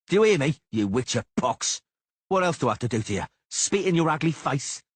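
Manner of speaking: mock his courage loudly; furious taunting duelist; taunting shout. A narrator's reading. Cicada desperate insults.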